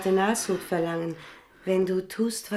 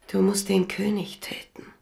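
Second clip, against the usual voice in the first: ordinary talk, nothing marked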